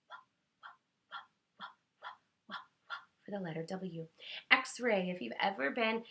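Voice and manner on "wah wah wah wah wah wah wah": breathy unvoiced whooshing wah; wind wah. Shown as a demonstration. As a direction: whisper wah like blowing wind. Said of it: "hear it going wah".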